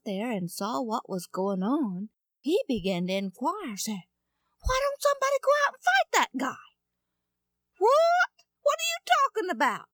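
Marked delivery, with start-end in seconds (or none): 4.6-6.6 put-on high, fast boyish voice; eager young boy asking around; piping young boy's question; young David. Boy David asks the soldiers. 7.8-9.9 very high, loud surprised voice; high mock-astonished soldier. Soldiers answer David.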